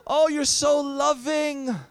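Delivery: high, long-drawn mock swooning; gushing stewardess. Ticket lady adoring the traveler at her counter, mock gushing. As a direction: imitate her slow, adoring gush. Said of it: "She says".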